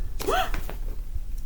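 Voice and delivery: unvoiced startled gasp, high; a sudden shocked intake of breath; sharp gasp. A narrator's reading.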